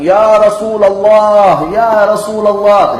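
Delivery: loud, high, long drawn-out call; deliberate calling shout. Retold or imitated. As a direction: call out to him from far off, stretching each word. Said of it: "Abu Bakar sengaja teriak". (teriakan memanggil yang nyaring dan panjang)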